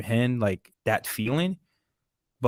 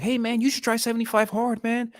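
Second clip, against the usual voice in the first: hyped up, much higher pitch; excited; 13 semitones higher than usual. Retold or imitated. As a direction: sell it like a pumped friend. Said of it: "I was like".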